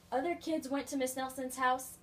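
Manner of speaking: plain reading voice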